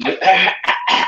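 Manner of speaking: imitating a voice gone after shouting; strained lost voice. Host showing the doctor a voice after a concert, hoarse imitation. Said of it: "parang ganun ang boses mo".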